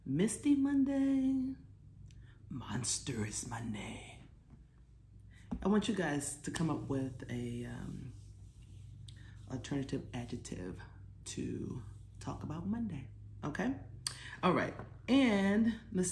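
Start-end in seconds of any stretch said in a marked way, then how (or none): 0.1-1.5 high and slow, dreamy and floaty; airy high voice. Shown as a demonstration. Teacher voicing a dreamy misty Monday for kids. 2.5-4.2 low, slow and softer, a growly monster. Shown as a demonstration. Teacher voicing a monstrous Monday to delight kids. 11.2-13.0 whisper. Real, not acted.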